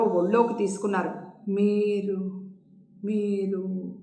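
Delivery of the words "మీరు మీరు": soft drowsy question; eyes closed, drowsy. A narrator's reading. Jayanthi half-asleep asking who holds her, softly. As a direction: ask softly with eyes shut. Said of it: "కళ్ళు మూసుకునే అంది".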